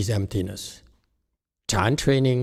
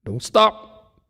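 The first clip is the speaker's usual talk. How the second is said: high, loud command to keep going; coaching shout; 12 semitones higher, 5 dB louder than usual. Acted. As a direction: bark it like a drill instructor. Urging martial arts trainee.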